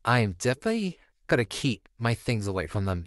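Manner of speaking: ordinary delivery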